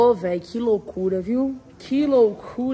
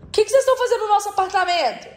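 higher fast indignant question; excited demand; 7 semitones higher, 3 dB louder, much faster than usual. Real, not acted. Shouting at the neighbours' noise.

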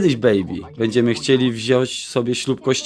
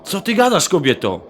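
shocked; 8 semitones higher than usual. Acted. Shocked reaction in couple quarrel.

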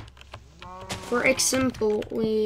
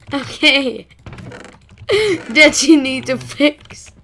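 loud, high, laughing; laughing complaint; 4 semitones higher, 9 dB louder than usual. Real, not acted. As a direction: laugh while scolding the game. Complaining about server bug.

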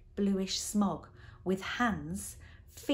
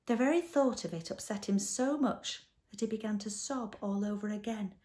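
usual speaking voice

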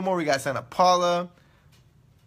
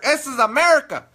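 higher, louder "America"; mock outrage; 8 semitones higher, 6 dB louder than usual. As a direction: blurt it in hammed-up indignation. Mock outburst at Spanish words.

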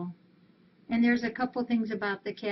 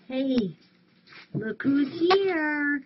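cooing greeting; 5 semitones higher, 4 dB louder, much slower than usual. Real, not acted. Greeting a cat arriving.

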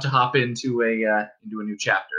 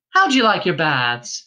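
higher, louder caring question; solicitous innkeeper's wife; 5 semitones higher, 4 dB louder than usual. Acted. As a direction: fuss over the guest's bath. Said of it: "she asked solicitously".

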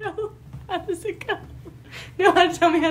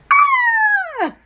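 very high, loud squeal; excited shriek; 5 dB louder than usual. Real, not acted. Excited on a boat.

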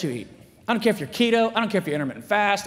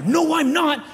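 mock defiant kid; 9 semitones higher, 5 dB louder, slower than usual. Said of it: "I was in a big fight with my mom".

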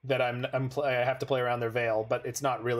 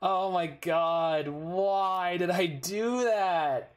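drawn-out, slow groan of self-reproach; slow exasperation; 4 semitones higher, much slower than usual. Real, not acted. Player asking himself why he misplayed, slow and exasperated. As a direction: stretch it out, disbelieving your own blunder. Regretting his own misplay.

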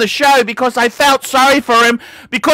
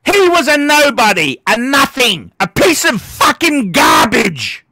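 pissed off; 2 semitones higher than usual. Real, not acted. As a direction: hurl the insult in real anger. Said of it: "I'm fucking pissed off".

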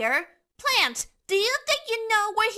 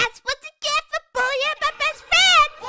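high, indignant cartoon outburst; 8 semitones higher, 4 dB louder than usual. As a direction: tell off the bully, pitched way up. Character scolds a bully.

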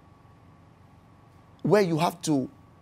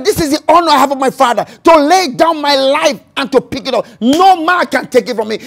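louder, faster declaration; preached louder; 7 semitones higher, 9 dB louder than usual. Shown as a demonstration. Preacher repeating Jesus' words to the church, proclaimed louder. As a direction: proclaim it to the back row. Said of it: "he said (repeated, louder)".

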